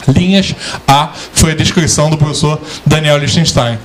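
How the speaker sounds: normal talking voice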